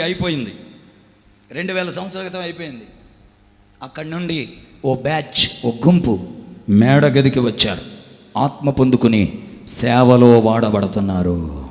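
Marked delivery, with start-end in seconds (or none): 3.8-11.6 loud, low, slow declaration; pounding sermon climax; fiery preaching. Acted. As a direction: drive each word home. Preaching at full force.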